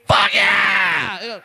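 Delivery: drawn-out shout of joy; long, triumphant holler; elated cheer. Acted. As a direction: celebrate wildly as the lucky patient. Patient cheering at getting a midget.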